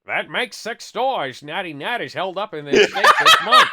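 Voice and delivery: exaggerated silly read-aloud voice; funny character voice. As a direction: ham it up slowly, cracking people up. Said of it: "can you read this in a funny voice".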